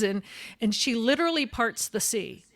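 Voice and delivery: ordinary delivery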